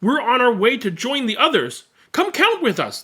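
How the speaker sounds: a hint of eager friends inviting; cheerful numbers calling. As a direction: call out to her like a happy crowd.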